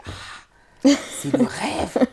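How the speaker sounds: souffle d'abord « Ah », puis lance « c'est le rêve » plus haut et plus fort; émerveillement à mi-voix qui éclate; émerveillée. Retold or imitated. Découvre la salle de classe. (« c'est le rêve » dit avec émerveillement)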